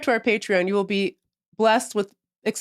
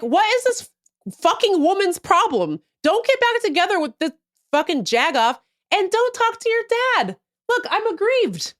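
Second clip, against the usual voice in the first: mock outrage; 9 semitones higher than usual. A player rants at a mobile game heroine in mock fury. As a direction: vent at the game character like she's in the room. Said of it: "I'm like".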